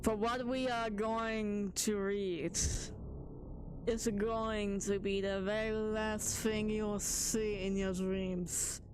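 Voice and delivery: slow, hushed horror-story voice; mock spooky narration. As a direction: lure the chat in like a campfire tale. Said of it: "now we're gonna go serious mode".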